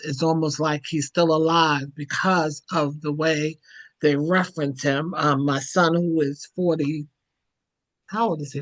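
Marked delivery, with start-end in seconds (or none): none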